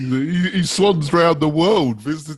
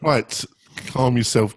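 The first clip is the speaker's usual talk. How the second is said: ordinary talk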